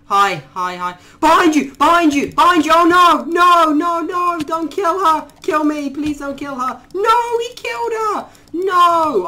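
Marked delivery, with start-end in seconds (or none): 1.2-9.2 very high, loud warning yells; frantic shouting. Real, not acted. Gamer warning his new friend of an attacker, frantic yells. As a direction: yell the warning in panic. Warning creature, then its death.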